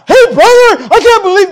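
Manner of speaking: high, loud, indignant confrontation; the wrong way to confront a brother, high and loud; accusing outburst. Acted. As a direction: burst out accusing your brother. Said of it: "no, no, no".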